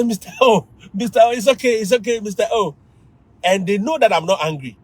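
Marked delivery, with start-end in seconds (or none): 0.0-2.7 raised-pitch pleading to calm teacher; smooth it over in a student's higher voice; placating student. Retold or imitated. Retold students placating the laughing teacher.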